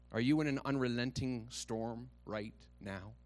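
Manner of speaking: ordinary delivery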